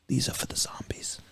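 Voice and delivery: hushed, soft whisper; conspiratorial whisper. Acted. Man arming himself against zombies, dramatic whisper. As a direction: whisper it like a dramatic movie line. Acting out his secret preparation.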